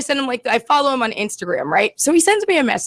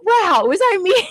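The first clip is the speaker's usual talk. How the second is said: wondering aloud; 6 semitones higher, slower than usual. Retold or imitated. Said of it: "I'm just sitting there ... like".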